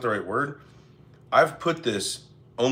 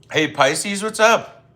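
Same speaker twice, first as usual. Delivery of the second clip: excited greeting; 9 semitones higher, 7 dB louder, much slower than usual. Real, not acted. Greeting chatter in live stream.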